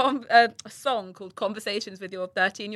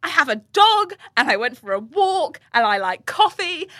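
raised, loud, gushing list; hammed-up emotional self-talk; retold tearful gratitude; 6 semitones higher, 5 dB louder than usual. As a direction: pile up blessings as if overcome. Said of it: "like, crying".

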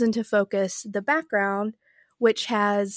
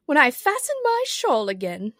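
slightly raised, mildly pained queenly voice; groaning Queen; 4 semitones higher, 4 dB louder than usual. A narrator's reading. The poor Queen tells Alice about her shawl, mildly groaning. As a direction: lightly groan it as a put-upon queen. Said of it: "the poor Queen groaned".

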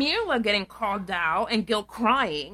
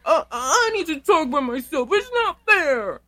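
loud, high tantrum imitation; mock whiny complaint; 6 semitones higher, 6 dB louder than usual. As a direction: wail it like a sulking child, drawing out the end. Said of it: "complaining to the producers".

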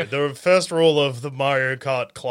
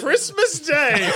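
mocking; 11 semitones higher, much slower than usual. Mocking joke repeat.

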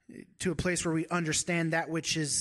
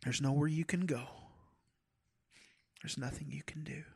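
softer, hushed reassurance sinking to a whisper; tender father God; 3 dB quieter than usual. Pretend. God to his little children, softly reassuring, near a whisper. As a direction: soothe a hurting child, quietly. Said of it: "He's saying".

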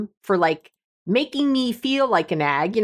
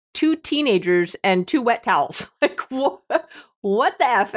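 ordinary talk

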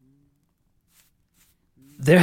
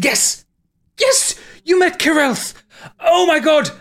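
much higher and louder, bursting with joy; thrilled scholar; 3 dB louder, slower than usual. Acted. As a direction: yell it like the breakthrough of his life.

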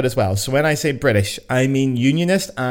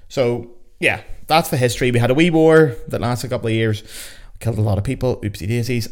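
ordinary talk

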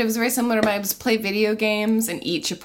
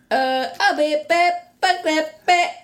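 fake echo; 7 semitones higher, 4 dB louder, slower than usual. Leaving with a mock echo.

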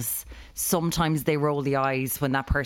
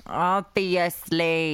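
very slow, stretched-out echo; teasingly drawn-out agreement; mimicking echo; much slower than usual. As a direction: echo it syllable by syllable. Echoing other speaker's drawn-out word.

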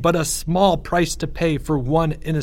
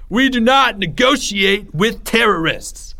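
high, slow, grand proclamation; crying out; 9 semitones higher, 3 dB louder, slower than usual. A narrator's reading. Greg defies the note before all, crying out. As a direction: declare it like a statesman to a crowd. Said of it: "Greg cried".

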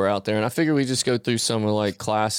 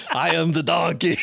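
higher, hammed-up Arnold accent; playful Arnold-style declaration, pitched up; Arnold Schwarzenegger accent; 5 semitones higher than usual. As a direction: proclaim it in an Austrian accent. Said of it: "our man Arnold would have done it".

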